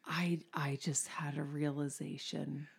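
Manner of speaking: hushed whisper. Real, not acted. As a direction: whisper it to yourself. Sudden hushed aside.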